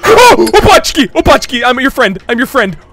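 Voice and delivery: very high, loud frantic pleading; panicked shrieking. Real, not acted. Player telling Opachki I'm your friend, panicked shrieking. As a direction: shriek it, begging to be spared.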